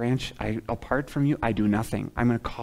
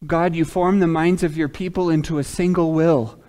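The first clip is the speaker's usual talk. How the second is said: raised, ringing prayer delivery; fervent prayer; 5 semitones higher, 5 dB louder than usual. Real, not acted. Preacher praying the prayer of the day, fervent. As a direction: pray it out in earnest, pitched up.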